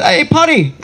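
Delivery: high-pitched Northern Irish mate calling out; Northern Irish accent. Retold or imitated. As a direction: imitate a Northern Irishman offering his pal a treat. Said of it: "These were three Northern Irish".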